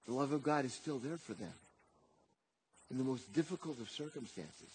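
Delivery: low, soft, grave delivery; quiet, low pastoral solemnity; hushed solemn preaching. Acted. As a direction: bring it right down and let it sink in. Preacher drops to hushed, solemn appeal.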